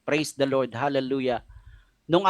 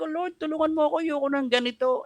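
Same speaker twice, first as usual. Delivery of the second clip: a high, softened plea, lightly tearful; retold crying prayer; 10 semitones higher than usual. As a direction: pray like a broken father, holding back tears. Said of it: "Umiiyak ako". (mahinang pakiusap na parang umiiyak)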